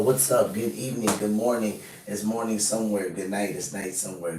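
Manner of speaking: ordinary talk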